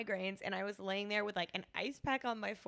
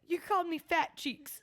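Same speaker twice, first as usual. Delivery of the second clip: laughing quote; 8 semitones higher than usual. Retold or imitated. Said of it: "He says".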